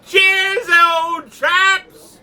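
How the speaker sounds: high, hammed-up English gent; mock posh toast. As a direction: raise a glass like a pompous old toff. Mock toast to the pub crowd.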